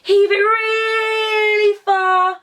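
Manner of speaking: coaching a big pull, straining. Shown as a demonstration. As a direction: heave with everything you've got. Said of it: "pull it even more".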